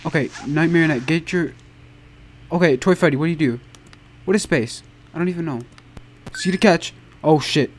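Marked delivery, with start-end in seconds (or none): none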